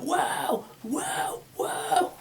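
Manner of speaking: high, softening echo fading to breath; fading mic echo. Shown as a demonstration. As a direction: pull the mic away as you say it.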